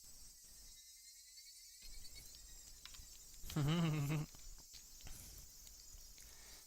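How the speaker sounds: a long, high, unsettling giggle; eerie high laugh. Acted. The girls laugh eerily at the dead foreman, unsettling. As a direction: let the laugh creep out and linger.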